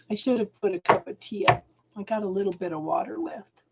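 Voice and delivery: ordinary delivery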